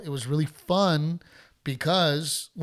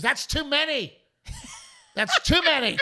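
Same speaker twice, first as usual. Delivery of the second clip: high, squeaky mock voice of a heckler, laughing; mock heckler woman; 12 semitones higher than usual. Heckler woman telling the comic his cancellations are too many, squeaky mocking voice. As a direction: squeal it like a petty fan. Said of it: "She goes".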